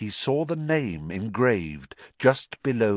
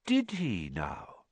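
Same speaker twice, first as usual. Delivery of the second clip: put-on slow, soft musing old wizard; quiet, slow and considering; old headmaster gently weighing it; thoughtful; 3 semitones higher, 6 dB quieter, slower than usual. A narrator's reading. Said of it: "looking thoughtfully".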